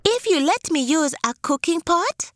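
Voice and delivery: high, coaxing tortoise voice; sly tortoise. Acted. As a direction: tempt them sweetly. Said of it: "said the tortoise".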